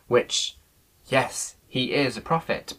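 ordinary talk, nothing marked

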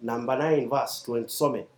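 loud, emphatic preaching read; scripture reading. Acted. Preacher reads James to the congregation, forceful. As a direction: preach the verses at full force.

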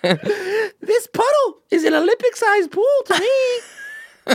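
slow, very high tiny-person squeak; drawn-out high squeak; silly voice. Pretend. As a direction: marvel like a shrunken person in squeaky pitch. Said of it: "A voice like this one".

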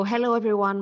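normal talking voice

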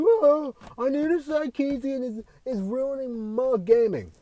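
high, whiny mock gamer voice, slowing at the end; mocking salty gamer. Mocking angry gamers who blame Anita Sarkeesian, high whiny voice. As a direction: pitch up and whine like a sulking fan. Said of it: "they get all salty and mad".

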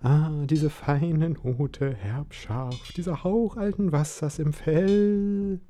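slow, rising with delight; dreamy savouring. A narrator's reading. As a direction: savour every word dreamily. Caruso savours the scent.

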